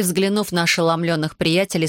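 ordinary delivery

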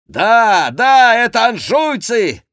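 high, very loud outcry; shouting. A narrator's reading. Quélus joins the outcry against the Angevins, shouting. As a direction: yell it in furious agreement. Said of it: "закричал Келюс".

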